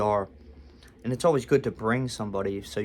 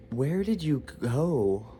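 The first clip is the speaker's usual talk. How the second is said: slow, hesitant mock question; mildly uneasy, stretched-out asking; nervous; 3 semitones higher, slower than usual. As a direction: imitate a nervous receptionist, drawing the words out. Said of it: "And she was nervous".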